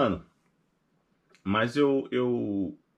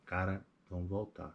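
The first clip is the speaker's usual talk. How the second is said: low, soft, resigned; quiet weary echo; 6 semitones lower, 11 dB quieter than usual. Retold or imitated. Wife agreeing to go home, very soft and resigned. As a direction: let it sink out quietly, giving up.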